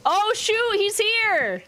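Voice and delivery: high, loud surprised hello; excited greeting. Real, not acted. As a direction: greet a friend who just showed up. Friend suddenly joins chat.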